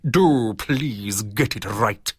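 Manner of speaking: slow, sneering and condescending; slow condescending sneer at Barbara Windsor. A narrator's reading. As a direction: drag it out slowly to belittle her. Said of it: "he sneered".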